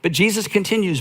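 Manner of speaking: normal talking voice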